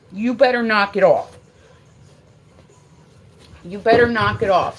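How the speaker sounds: loud, forceful threat to a pet; real angry telling-off; stern warning. Real, not acted. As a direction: warn the dog at full voice. Warning the dog.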